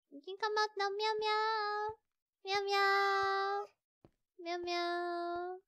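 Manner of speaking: soft singsong cat call; singsong coaxing. Real, not acted. Player calling the hiding cat out, singsong coaxing. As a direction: coax gently on held notes.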